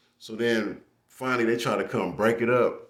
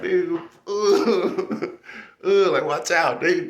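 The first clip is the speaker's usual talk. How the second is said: laughing; 8 semitones higher, 4 dB louder, slower than usual. Retold or imitated. Yelling while hitting with helmet.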